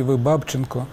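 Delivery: usual speaking voice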